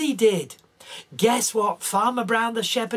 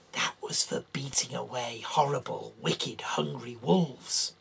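slow, hushed whisper; spooky whisper; 8 semitones lower, 8 dB quieter than usual. A narrator's reading. Storyteller to children, slow spooky whisper. Describing the club for wolves.

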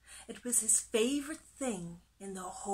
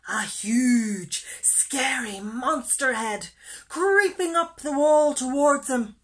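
loud narration climbing higher; dramatic scary reveal; 4 semitones higher, 12 dB louder than usual. A narrator's reading. Storyteller revealing a monster, loud and dramatic. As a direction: build the menace, getting higher and staying loud.

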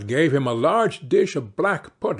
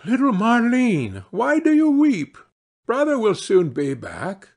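higher, lightly voiced father soothing his daughter; gentle father; 7 semitones higher than usual. Father to his crying daughter, soothing in a higher voice. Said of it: "and said".

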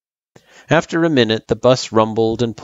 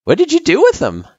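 put-on young girl voice; 5 dB louder, faster than usual. Frisk asks Gerson.

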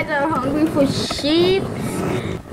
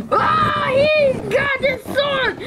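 panicked shout; 11 semitones higher than usual. Real, not acted. Panic when enemy gets sword.

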